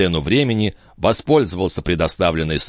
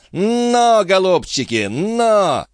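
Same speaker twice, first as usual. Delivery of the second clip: high driver's call; urging horses on; 13 semitones higher, much slower than usual. A narrator's reading. Jean drives off the seized horses, urging them on. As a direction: call the horses on with raised pitch.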